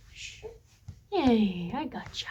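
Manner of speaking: very soft, tender reassurance; soothing hush. Real, not acted. Parent hushing their small daughter off screen, soft and soothing. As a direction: hush the little one gently.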